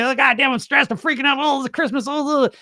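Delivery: high, frantic mock panic over holiday stress; mock freak-out. Voicing a stressed person at Christmas for the viewers, high frantic mock panic. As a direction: squeal it like a frazzled holiday host. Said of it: "So it's like, you may".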